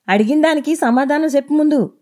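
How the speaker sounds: shouted demand; shouting. A narrator's reading. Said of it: "అని అరిచాడు".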